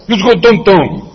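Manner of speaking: "Let's go downtown" run together, downtown as "dun-tun"; Nuyorican pronunciation. Shown as a demonstration. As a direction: blur downtown into "don-ton", Nuyorican style. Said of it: "si yo te digo".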